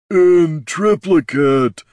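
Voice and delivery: very slow, loud ogre rumble; rumbling ogre. Acted. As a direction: drag it out, big and booming. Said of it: "rumbled Mister Brunt".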